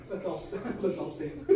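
words squeezed out through laughter; laughing. Real, not acted. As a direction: try to talk while still laughing. Still laughing after the joke.

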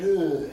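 loud worn-out groan, out of breath; exhausted groan. Retold or imitated. Preacher reliving the climb, a loud exhausted groan. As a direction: let out a spent grunt halfway up.

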